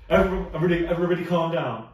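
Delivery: loud, high sitcom boss yell; quoting The Office at full volume; Michael Scott. Retold or imitated. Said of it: "I'll probably not get the reference while I say it".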